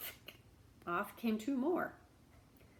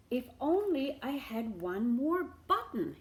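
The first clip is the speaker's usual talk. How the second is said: louder wistful hint; hinting beggar; 4 semitones higher, 5 dB louder than usual. Acted. Beggar hinting to the shamus, wistful. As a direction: say it pointedly for him to hear.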